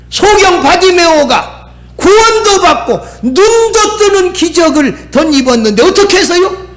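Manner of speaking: high-pitched, rousing sermon delivery; impassioned preaching. Real, not acted. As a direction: preach it out to the congregation.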